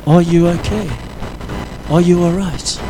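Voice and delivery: soft, quiet concern; gentle caring question. Retold or imitated. Stranger quietly asking a hurting young man, soft caring. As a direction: ask gently, as if noticing someone's hidden pain. Longing for one caring person.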